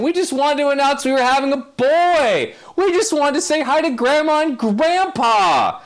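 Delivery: very high-pitched wailed protest of innocence; hammed-up high, whiny imitation; mock pleading. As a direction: plead it in a high put-on voice, stretching the ends. Acts out well-meaning people protesting innocence.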